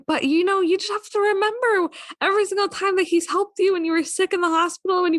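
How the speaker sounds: high, put-on motherly lecture; imitated mother's voice. Daughter quoting her mother's reminder about her husband, put-on high mom voice. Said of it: "I don't know why I used that voice".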